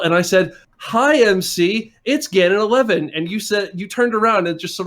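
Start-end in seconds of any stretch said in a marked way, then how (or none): 0.8-3.1 called-out greeting. Retold or imitated. Retold greeting called from an escalator.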